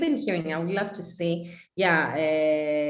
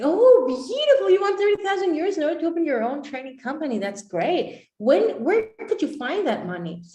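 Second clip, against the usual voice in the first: high, loud mock-sweet coach, fast; sugary coach; 10 semitones higher, 7 dB louder than usual. Coach gushing at the client's figure, high and loud.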